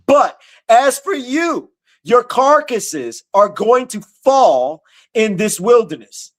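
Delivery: slow, high, ringing scripture reading; preaching scripture. Acted. Preacher reading God's words to the congregation, slow and ringing. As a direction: proclaim each phrase slowly as the voice of God.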